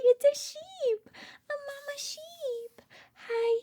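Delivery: usual speaking voice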